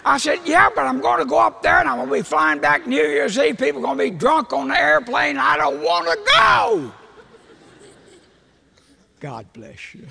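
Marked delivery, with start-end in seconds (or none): 0.4-6.9 high, whining protest building to a shout; loud complaining. Retold or imitated. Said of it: "I complain. Now, I'm a complainer". 9.2-10.1 hushed, low, unruffled blessing; reply softly and low to a rant; calm wife. Retold or imitated. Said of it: "She just is the calmest person".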